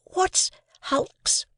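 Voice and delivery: whispered question. Acted. Boy asks in a whisper.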